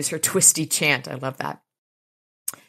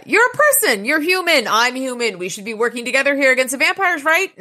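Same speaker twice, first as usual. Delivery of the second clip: high, loud, pleading holler; 7 semitones higher, 4 dB louder than usual. Acted. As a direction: holler at her to listen. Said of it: "he starts hollering at Barbie".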